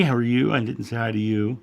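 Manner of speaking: ordinary delivery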